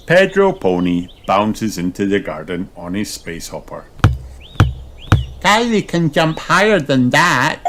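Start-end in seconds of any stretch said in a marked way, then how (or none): 5.4-7.6 high boastful piglet voice; Peppa. A narrator's reading. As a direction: brag for your friend. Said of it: "says Peppa".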